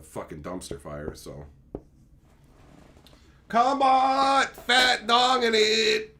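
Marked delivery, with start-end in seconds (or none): 3.5-6.2 slow, hammed-up callout; higher, drawn-out mock shout. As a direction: bellow the name like a joke. Reading out a viewer name.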